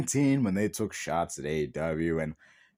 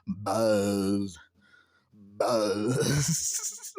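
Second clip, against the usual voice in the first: mock Moxley buzz; 7 semitones lower than usual. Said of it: "you just give out some stuff like".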